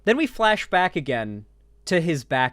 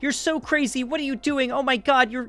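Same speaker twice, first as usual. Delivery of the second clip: high-pitched mock girlfriend panic, rapid repeated protests; hysterical girlfriend; 11 semitones higher than usual. Girlfriend caught cheating yells at her boyfriend, hammed-up hysterics. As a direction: put on a high, frantic girlfriend voice.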